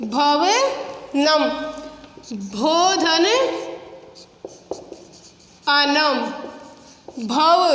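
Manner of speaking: भवनम stretched out very slowly, each syllable held; teacher dictating भवनम slowly; slow sounding out. Shown as a demonstration. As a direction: draw out भवनम so pupils catch every sound. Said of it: "teacher repeats the word slowly".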